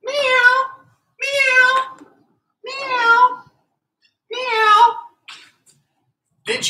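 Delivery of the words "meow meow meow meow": cat meows. Shown as a demonstration. Coach meowing as his favourite animal for the kids. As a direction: meow like a little cat. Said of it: "act like your favorite animal".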